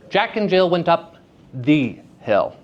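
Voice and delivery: "the" set apart and said long as "thee"; stress on the. Shown as a demonstration. Teacher showing the class a shifted meaning, stress on "the". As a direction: isolate "the" before "hill". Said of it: "based on where you place".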